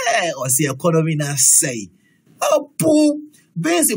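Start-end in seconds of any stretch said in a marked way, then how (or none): 2.4-3.9 mock dismay. Exclaiming over bean prices.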